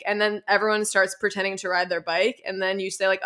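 usual speaking voice

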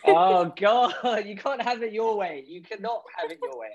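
high-pitched mock despair, easing off; teasing exasperation. Real, not acted. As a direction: burst out exasperated, then settle into a refusal. Teasing exasperation at hedged answer.

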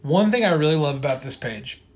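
ordinary delivery

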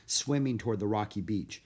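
ordinary delivery, nothing marked

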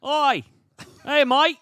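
high-pitched, loud, hammed-up Australian; Australian accent. Shown as a demonstration. Performing on his wife's request, high-pitched mock Australian accent. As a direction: push the pitch right up for a cartoon Australian holler. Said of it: "Please do Australian".

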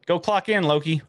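high, loud bossy order; bossy manager. Pretend. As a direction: order him to clock in. Teasing Loki like a boss.

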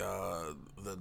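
ordinary talk, nothing marked

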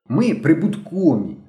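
understated narrated yell; mild shout. A narrator's reading. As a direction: call it out proudly, half-shouting. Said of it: "крикнув Генка".

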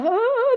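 sudden high, loud laugh breaking into tears; a burst of teary laughter, high and loud; laugh-sob. Retold or imitated. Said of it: "Then I start crying".

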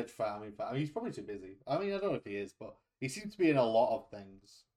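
ordinary talk

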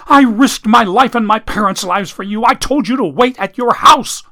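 furious high yell at him. Acted. Ellie yells at Eustace for not waiting, furious. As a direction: scream it right in his face. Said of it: "she pushed him away and yelled".

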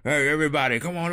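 barking orders through a cigar; bossy plant manager. Retold or imitated. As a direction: round up the workers like a foreman. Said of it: "the guy that has the cigar".

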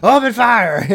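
loud high order to open fire; mock shouted command. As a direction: bark it like an ambush leader.

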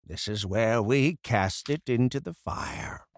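lightly voiced mentor, hushed and slow; wise blacksmith. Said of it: "and he says".

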